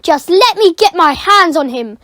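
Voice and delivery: loud furious threat. Acted. Furious caretaker hunting the boy, loud angry threat. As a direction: spit out the threat.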